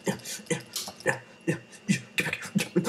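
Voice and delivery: usual speaking voice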